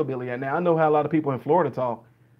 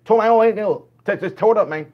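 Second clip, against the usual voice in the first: put-on rapid, loud, higher Southern accent; fast Southern dialect; 4 semitones higher, 4 dB louder, faster than usual. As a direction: tumble breathlessly through it in a Southern accent. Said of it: "The first Southern person to come in".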